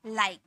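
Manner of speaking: लाइक ending on the k; लाइक with no vowel after k; silent final e. Shown as a demonstration. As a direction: say लाइक as one syllable, "like". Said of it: "ई silent होता है".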